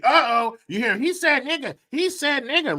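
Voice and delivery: high-pitched tattling cry; mock alarm. Mocking kids who find their opportunity, alarmed outcry.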